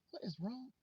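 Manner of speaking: softly bewildered; baffled aside. Retold or imitated. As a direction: imitate a kid asking under the breath. Child's baffled aside, retold.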